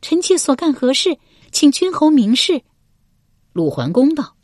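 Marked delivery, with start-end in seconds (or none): 0.0-2.6 high, defiant female voice; high-pitched challenge, putting up a front; brazening it out. A narrator's reading. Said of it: "文姜硬着头皮道".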